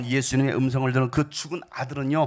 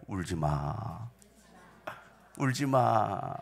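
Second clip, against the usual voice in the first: comforting; 6 semitones lower, 5 dB quieter, much slower than usual. Shown as a demonstration. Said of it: "옆 사람 보면서 얘기해 줄까요?".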